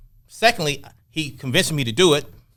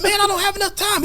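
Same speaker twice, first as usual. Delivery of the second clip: exasperated protest. Retold or imitated. Retold heated argument.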